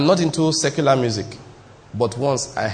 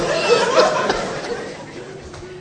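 airy giggle, pitched up; breathy high laugh. Real, not acted. Preacher giggling while telling congregation the tale. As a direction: let a wheezing laugh escape.

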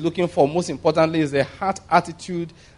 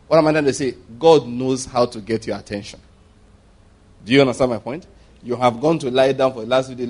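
normal talking voice

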